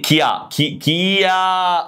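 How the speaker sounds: "كيا" split as ki, then a long kyaaa; syllable breakdown. Shown as a demonstration. Teacher shows where the A goes, "كيا" stretched high as kyaaa. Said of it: "شايف قلت ايه؟".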